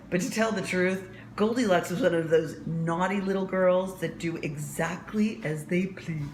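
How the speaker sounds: plain reading voice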